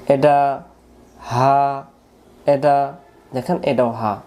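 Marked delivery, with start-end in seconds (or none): none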